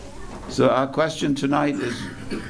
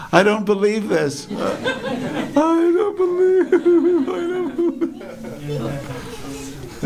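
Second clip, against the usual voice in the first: high, hammed-up sobbing wail; mock bawling, stretching the words out very high; imitated crying; much slower than usual. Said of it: "But he was crying, crying, crying".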